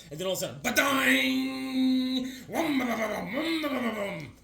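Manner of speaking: high, chanted vocal sound effects; transformation sound effects. Retold or imitated. Narrating the hero's transformation with high, chanted sound effects. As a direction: sing out the power-up noise.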